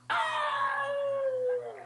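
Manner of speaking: very high, sustained "oh"; mock love-struck squeal; swooning girl. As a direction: squeal one long high note of delight. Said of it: "look at it and go".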